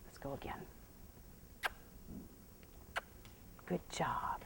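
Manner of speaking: soft low whisper; relaxed hush. Real, not acted. Trainer exhaling and whispering so the horse relaxes. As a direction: breathe it out calmly. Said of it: "I just exhaled to relax".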